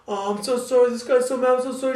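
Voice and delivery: high, panicky imitation of the crash driver; a hinted hysterical woman, sorry over and over; frantic apology. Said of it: "She's like".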